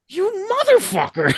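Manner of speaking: very high, strained mock curse; high mock-outraged squeal. [name] to singer handed [name]'s mic, high squealed mock curse. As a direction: squeak it in comic envy.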